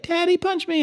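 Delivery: put-on high, whiny little kid begging; high-pitched kid voice pleading with daddy; child's plea. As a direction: mimic a small child asking to be hit. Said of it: "they'll be like".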